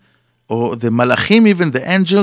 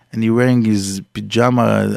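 normal talking voice

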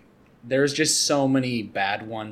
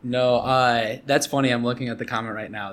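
usual speaking voice

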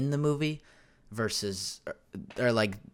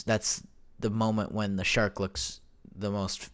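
usual speaking voice

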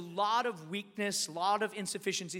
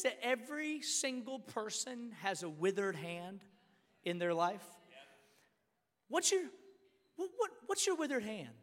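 ordinary talk

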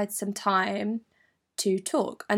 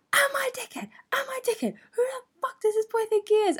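mock screaming; faster than usual. Screaming down the phone to best mate, mock high squeal. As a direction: imitate a strained high-pitched phone rant. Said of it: "I was screaming down this phone".

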